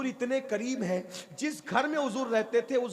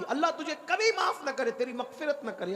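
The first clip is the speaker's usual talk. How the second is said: cursing; 6 semitones higher than usual. Acted. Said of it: "उसने बद्दुआ दी".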